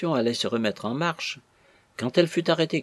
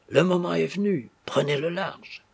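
lightly acted urgent prompting, pitched up; urging on; 6 semitones higher than usual. A narrator's reading. Captain nudging the bridegroom to flee, urgent. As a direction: press him to go, voice lifted.

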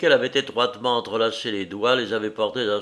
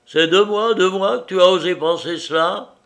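higher, louder outraged disbelief; indignant; 8 semitones higher, 7 dB louder than usual. Acted. Liuba outraged that he thought this of her, louder. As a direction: fling the question back at him.

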